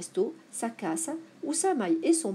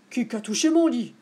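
lightly voiced big bear, louder; a hint of Papa Bear complaining; big bear's cry; 3 semitones higher, 5 dB louder than usual. A narrator's reading. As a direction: exclaim it as the big bear, mildly indignant. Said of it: "s'écria Grand Ours".

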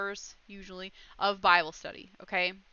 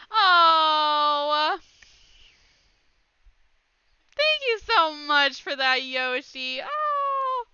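loud, high squeal of thanks; real overjoyed shriek and thanks; excited gratitude; 5 semitones higher, 8 dB louder, much slower than usual. Real, not acted. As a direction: burst out in delighted surprise. Streamer thanks viewer for gift.

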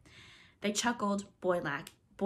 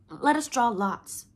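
bravely; 4 semitones higher, 9 dB louder, slower than usual. A narrator's reading. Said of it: "Wendy said bravely".

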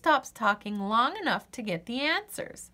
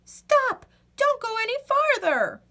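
much higher, loud urgent warning; frantic squirrel; 13 semitones higher, 4 dB louder than usual. Acted. Squirrel darting in front of Birdie, loud high alarm. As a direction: shout the warning in a squeaky squirrel voice. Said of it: "Squirrel zips in front".

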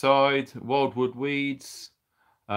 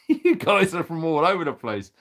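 laughing; 4 semitones higher, 4 dB louder than usual. Real, not acted. Laughing at the flood of viewers.

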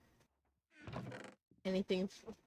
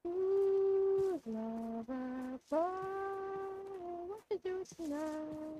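soft, high mock-seductive coo; flirty tease; 10 semitones higher, 8 dB quieter, much slower than usual. Flirty put-on voice at character.

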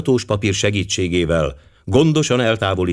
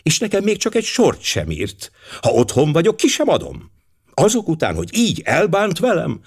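a pitched-up, indignant housewife; offended lady; 9 semitones higher than usual. Acted. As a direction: play the miffed mistress of the house, voice raised high. Mrs Todd complains to Poirot. (felháborodott úriasszony, magasabb hangon)

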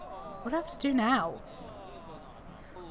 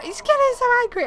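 high, loud, rushed alarm; alarmed outburst; 13 semitones higher, 8 dB louder, faster than usual. Real, not acted. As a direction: blurt it out high and fast. Game character's anger rising.

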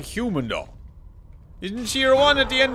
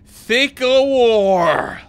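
very drawn out; much slower than usual. Shown as a demonstration.

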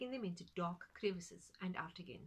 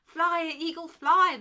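very loud high shout; cry out; 9 semitones higher, 20 dB louder, slower than usual. Acted. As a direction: hurl the command at the sky. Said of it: "the man cried".

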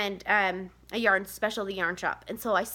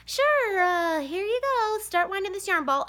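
high, sing-song fake-cheery mom voice; chirpy forced yes to the kids; fake cheerful; 9 semitones higher, slower than usual. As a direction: put on a bright smile over your exhaustion. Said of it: "And I'm like".

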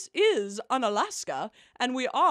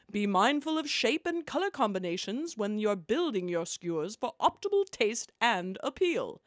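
ordinary talk

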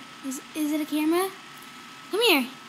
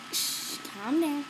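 soothing; 2 semitones lower, 7 dB quieter, slower than usual. Real, not acted. Soothing the excited dog.

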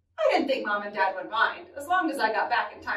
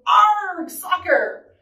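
loud pirate growl-word, raised high; yelling; 6 semitones higher, 5 dB louder, much slower than usual. Acted. Captain kicks the ball to his crew, yelling. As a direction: yell it as you kick off. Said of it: "kicked the ball and yelled".

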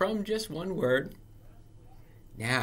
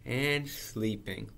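usual speaking voice

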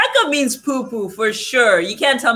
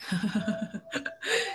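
quiet, rapid chuckle; soft chuckle; 13 dB quieter than usual. Real, not acted. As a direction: chuckle softly to yourself. Reacting to idea, laughing.

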